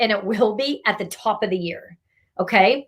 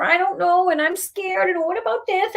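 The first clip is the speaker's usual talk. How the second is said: mock whiny excuses; 7 semitones higher than usual. Reader mocking the client's scared excuses, in a high whiny voice. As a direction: mimic someone hiding behind fears.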